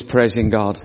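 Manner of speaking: usual speaking voice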